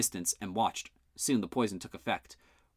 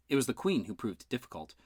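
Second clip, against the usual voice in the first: plain reading voice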